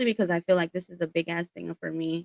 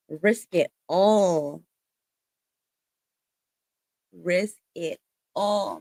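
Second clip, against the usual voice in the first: slow emphatic; much slower than usual. Real, not acted. Channelled message in the reading.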